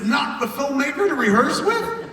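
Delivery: high, loud hammed-up outrage; over-the-top jealous dad tirade; mock outraged rant. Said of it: "I was so crushed".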